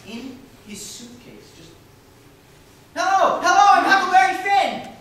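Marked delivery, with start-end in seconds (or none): 2.9-4.9 put-on loud high boyish greeting; eager shout. Boy announcing himself to a visitor, loud and high. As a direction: shout it out like a proud kid.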